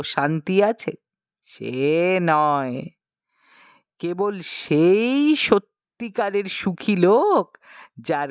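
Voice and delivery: a circus man mourning his lack of a cook; mock tragic lament.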